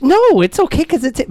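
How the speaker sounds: high, loud self-justifying comeback; retold defensive protest. Driver excusing the neighbor, defensive protest. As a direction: insist on the excuse.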